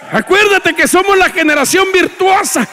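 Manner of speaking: loud, high preaching shout; rally the congregation from the pulpit; fervent pulpit cry; preaching at full force. Acted.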